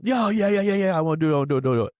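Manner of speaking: put-on high, loud, rapid-fire kid's pleading; eager kid begging. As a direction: beg like an excited little boy. Son begging to play football.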